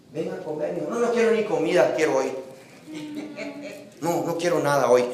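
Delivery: a hint of a sulky spoiled child. Preacher voicing a spoiled child answering his father. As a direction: whine back at the parent lightly. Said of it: "como de esos niños malcriados".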